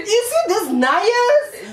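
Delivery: high, slow, laughing imitation; laughing mimicry. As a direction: drag it out through a laugh. Mocking the accent, laughing.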